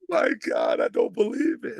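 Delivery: high, loud cry of disbelief; stunned outcry. Retold or imitated. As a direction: imitate a friend crying out in shock. Retold friend's stunned reaction.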